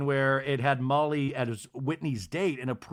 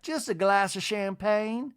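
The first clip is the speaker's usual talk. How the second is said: high, slow, lilting matron; Miss Patricia; 6 semitones higher, slower than usual. Retold or imitated. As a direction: imitate a grand lady placing her order, rising at the end. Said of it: "she tells Whitney".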